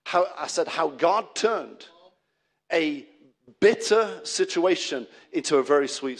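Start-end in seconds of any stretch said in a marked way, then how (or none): none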